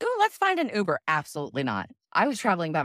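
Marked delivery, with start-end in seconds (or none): 0.0-1.0 high, breezy mock tourist voice; mock airy tourist voice. Mocking a family group hunting for an Uber, high and breezy. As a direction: chirp it like a carefree tourist.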